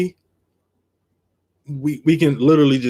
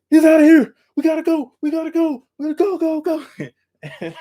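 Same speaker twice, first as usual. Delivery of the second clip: very high, urgent repeated "go"; urgent yelling; 3 dB louder than usual. Retold or imitated. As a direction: hurry your partner into the chase, fading at the end. Urging the chase.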